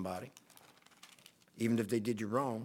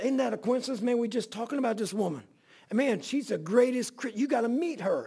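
excited; 8 semitones higher than usual. Retold or imitated. Excitedly pointing her out to Ronnie.

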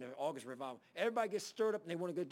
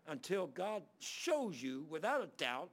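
slow counsel; 2 semitones higher, slower than usual. Retold or imitated. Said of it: "I said".

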